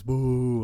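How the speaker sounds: drawn-out crowd boo; imitated booing. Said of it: "people were, like, booing us".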